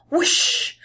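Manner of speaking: breathy rushing whoosh; whooshing sound. Pretend. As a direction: blow it out like rushing water. Imitating washing machine starting.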